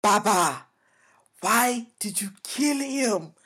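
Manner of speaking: put-on slow African-accented movie line. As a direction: quote it slowly in an African accent.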